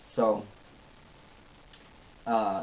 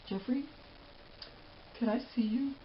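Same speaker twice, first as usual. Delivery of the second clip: higher, softer pleading voice; imitating Melissa; 9 semitones higher, 10 dB quieter than usual. Melissa asking Jeffrey for a word, high and soft. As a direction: coax him aside, high and hushed.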